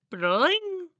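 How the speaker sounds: high, loud ringing "bling"; magic sound effect. A narrator's reading. Narrator voicing Starlight's shield spell, high bling. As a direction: sing out a sparkly magic noise.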